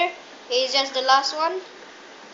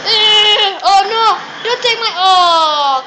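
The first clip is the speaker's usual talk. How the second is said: loud wail of dismay; frustrated cry; 4 semitones higher, 9 dB louder, slower than usual. Real, not acted. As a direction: cry out as the round is lost. Losing his kill in game.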